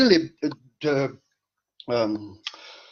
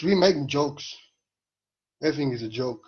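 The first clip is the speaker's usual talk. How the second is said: normal talking voice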